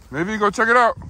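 high loud call to the dog. Real, not acted. Urging the dog outdoors.

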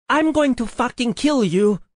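high, loud furious yell; yelled threat. A narrator's reading. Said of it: "Sage yelled at us".

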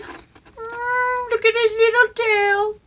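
very high, squeaky coo; cute squeal. Real, not acted. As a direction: squeal with delight over something tiny. Squealing over a cute toy tail.

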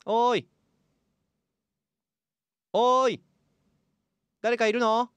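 high loud calls through a wall; calling through a wall. Acted. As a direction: call out to the next room.

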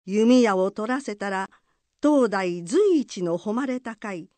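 ordinary delivery, nothing marked

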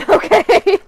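high, loud, laughing outburst; laughing okay. Real, not acted. Vlogger amused by their own crafting, laughing loudly. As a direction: burst out with it through a laugh.

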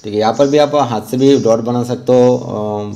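ordinary delivery

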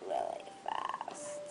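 hushed anticipation; slow breathy whisper. Acted. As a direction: whisper it slowly. Whispered answer.

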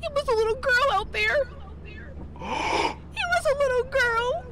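frightened, breath catching; scared. Real, not acted. Sees a ghost girl.

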